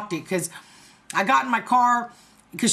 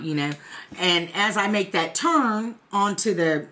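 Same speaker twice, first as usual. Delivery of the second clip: usual speaking voice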